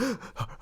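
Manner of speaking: imitating a scared mom's gasp; sudden high intake of alarm, acted out; mock startled gasp. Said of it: "Scared the crap out of her".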